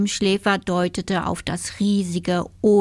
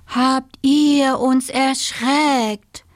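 high, slow, drawn-out exclamation; startled cry; 6 semitones higher, 4 dB louder, much slower than usual. A narrator's reading. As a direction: call it out, stretching every word. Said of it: "riefen die drei Fledermäuse".

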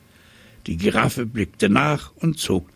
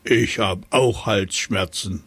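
trumpeting elephant. Acted. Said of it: "trompetete der Elefant".

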